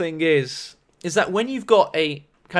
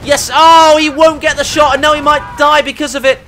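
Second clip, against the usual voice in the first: fast, louder, rising match commentary; excited play-by-play; 4 semitones higher, 6 dB louder than usual. Real, not acted. Caster calls a last-second tank shot, excited play-by-play. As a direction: build to a cry as the shot fails.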